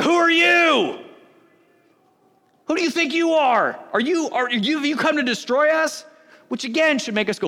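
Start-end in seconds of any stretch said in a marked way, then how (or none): 0.0-6.1 a man with an impure spirit shouting at Jesus in worship; possessed man's challenge. Retold or imitated. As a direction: hurl it at Jesus like an accusation. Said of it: "he's like".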